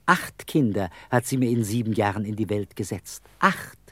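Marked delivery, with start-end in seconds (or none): 3.4-3.8 exasperated. Acted. Exasperated count of his children.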